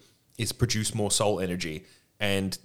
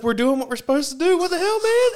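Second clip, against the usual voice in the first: much higher and louder, a shrieking outraged clerk; squealing Hell Priest; 6 dB louder than usual. Retold or imitated. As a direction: shriek the protest, rising at the end. Hell Priest's final indignant protest.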